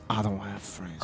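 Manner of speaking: deep, quiet tough-guy voice; low and cool like Vin Diesel. Retold or imitated. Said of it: "That's him doing a low voice".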